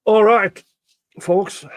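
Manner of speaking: loud, high call to the audience. Real, not acted. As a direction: call out to get everyone's attention. Calling chat back to attention.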